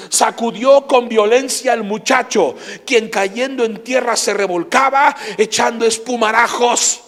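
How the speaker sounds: loud, high, slow pulpit proclamation; fiery revival preacher; full-force preaching. Acted. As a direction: thunder it from the pulpit, drawing out every phrase.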